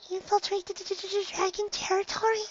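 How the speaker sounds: very high, soft stammering fear; frightened stutter. Acted. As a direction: stutter out the word in terror. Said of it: "Fluttershy asked".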